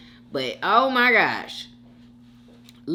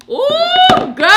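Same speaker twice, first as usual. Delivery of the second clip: high, loud squeal breaking into laughter; real tickled shriek; laughing outburst; 13 dB louder than usual. Real, not acted. Cracks up at decorum comment.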